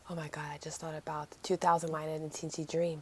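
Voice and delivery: whispered, low, stunned; hushed whisper. Real, not acted. Remembering the old song line, stunned whisper. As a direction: breathe it out in shock.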